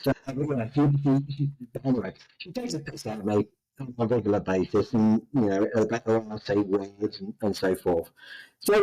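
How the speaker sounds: ordinary talk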